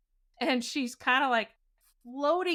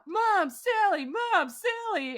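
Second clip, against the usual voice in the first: high, drawn-out mock calls; imitated yelling; 8 semitones higher, slower than usual. Roommate playing back the sleeper's yelling, high imitation. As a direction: mimic someone calling out, sing-out and high. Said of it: "Was I yelling?".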